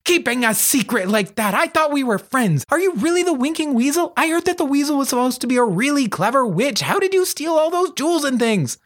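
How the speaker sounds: put-on high-pitched girl's torrent of questions; gushing outburst. A narrator's reading. Friend bombarding the secret thief with questions, high excited gush. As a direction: pour it out very high, like a dam breaking. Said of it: "it was like a dam broke".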